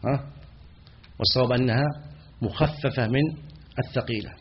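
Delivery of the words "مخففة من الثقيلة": ordinary talk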